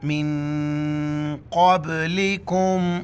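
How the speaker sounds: मिन held long as a nasal hum before क़ब्लिकुम; nasal ghunna. Shown as a demonstration. Teacher demonstrating for students, nasal ghunna on मिन. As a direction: chant मिन क़ब्लिकुम, sustaining the n in the nose. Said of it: "नाक में आवाज़ पैदा करेंगे".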